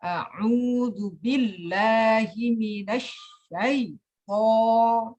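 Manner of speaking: very slow recitation with a thick, heavy "syaitho"; ta'awudz with exaggerated heavy to; heavy to sound. Shown as a demonstration. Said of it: "bacaannya itu gini".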